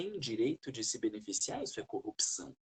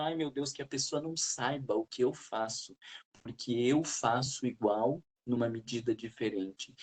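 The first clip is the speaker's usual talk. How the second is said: ordinary talk, nothing marked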